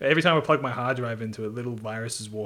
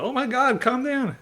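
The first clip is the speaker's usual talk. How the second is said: high, loud, half-laughing plea; mock exasperation; 14 semitones higher, 6 dB louder than usual. Gamer scolding his roaring console, high-pitched mock exasperation. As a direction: beg the machine to settle, overwrought. Acts out pleading with noisy console.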